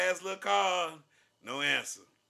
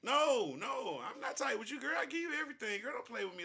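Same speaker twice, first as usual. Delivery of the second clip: playful protest; 6 semitones higher than usual. Real, not acted.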